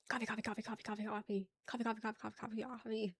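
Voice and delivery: soft, rapid-fire chant of the word; rapid repetition. Shown as a demonstration. Streamer testing how coffee is said, rapid quiet repetition. Repeating the debated word over and over.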